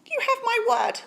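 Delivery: a small bright-eyed mouse piping up; tiny mouse voice. Acted. Polite mouse asks Bear for cheese, squeaky high.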